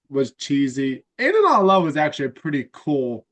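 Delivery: ordinary delivery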